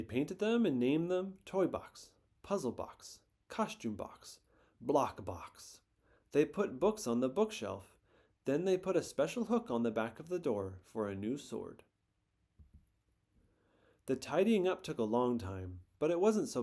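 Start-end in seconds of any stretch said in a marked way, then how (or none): none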